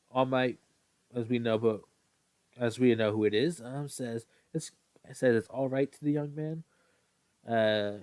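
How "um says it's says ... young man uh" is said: plain reading voice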